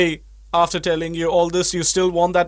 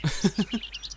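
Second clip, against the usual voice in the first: quiet run of chuckles; amused chuckle; 2 semitones higher, 10 dB quieter than usual. Acted. Amused at his friends' mistake, quiet chuckle. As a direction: chuckle softly at their folly.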